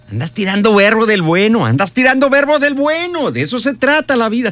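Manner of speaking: very high, gleeful whoop; exuberant exclamation. Acted. Cheering someone who talks up life, exuberant.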